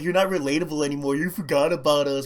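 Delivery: high, quick imitation of fans; mock complaining fans. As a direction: lightly mimic the grumbling audience. Acts out complaining fans turning away.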